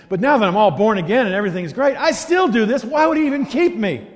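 high, rising self-reproach, building to the question; exasperated disbelief. Acted. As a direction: act out a baffled believer, pitch climbing. Acts out believer baffled at being kept.

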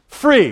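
Free punched high and loud; Free, loud and high with a fall; stress on Free. Shown as a demonstration. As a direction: hit Free hard, falling. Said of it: "That's the word they heard".

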